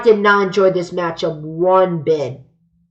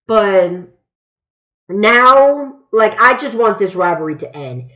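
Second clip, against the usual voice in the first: ordinary delivery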